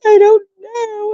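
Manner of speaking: high, drawn-out tearful whine; imitated blubbering answer; mock crying wail. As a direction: wail it like you're bawling, hammed-up. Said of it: "why are you crying".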